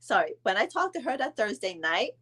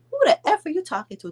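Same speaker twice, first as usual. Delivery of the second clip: higher, louder mock retort; mock indignant snap; 9 semitones higher, 4 dB louder than usual. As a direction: fire it back offended. Wife's retort retold.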